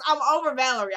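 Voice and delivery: usual speaking voice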